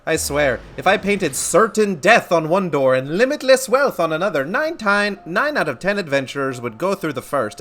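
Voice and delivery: put-on Momodi read in a loose Scottish accent; lively, uneven Scottish lilt for a game character. Momodi telling an adventurer about the Scions, loose Scottish accent. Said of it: "I gave her kind of a Scottish accent".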